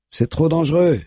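a high, sharp cry of alarm; shouted warning. A narrator's reading. D J shouts a warning from behind, high cry. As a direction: call out the danger from behind. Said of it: "crie D J derrière eux".

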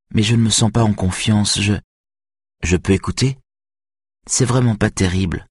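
a hint of a nervous girl doubting herself; young violinist Greta, unsure. A narrator's reading. Greta voiced by narrator.

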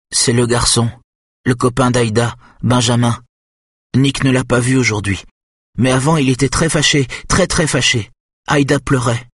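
put-on a child recounting an angry scene; simple boy, worried. A narrator's reading. Nick tells Kenneth about the angry boyfriend, upset.

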